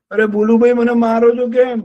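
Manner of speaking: high, loud mock complaint; hurt protest. Kicked donkey protests to Bholu bear loudly. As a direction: cry out at the bear.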